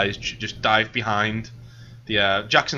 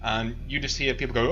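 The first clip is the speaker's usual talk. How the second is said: normal talking voice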